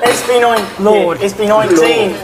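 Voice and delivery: put-on Australian accent; playful Australian impression. Shown as a demonstration. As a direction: do an Aussie accent on request. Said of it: "How about the Australian?".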